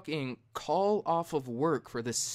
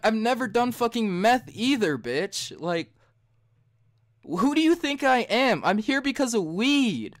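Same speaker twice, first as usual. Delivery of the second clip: much higher and louder, outraged; retold heated tirade, voice pitched up; indignant rant; 7 semitones higher, 6 dB louder than usual. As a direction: tell her off, offended at the question. Ranting back at the counselor.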